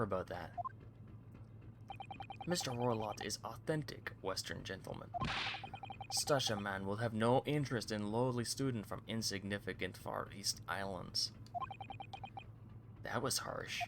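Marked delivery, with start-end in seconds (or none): 2.5-11.3 Russian sailor boasting. Acted. Guard brags about the VIP cabins, lightly Russian-accented. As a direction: show it off like a proud Russian guard.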